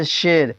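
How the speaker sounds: شیر said "sher", with an "e" vowel; unstretched ya. Shown as a demonstration. Teacher gives example of ya-e-majhool, شیر said "sher". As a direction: say the ی of شیر as "e", never "ee". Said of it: "جو یا کھینچ کر نہ پڑھی جاتی ہو".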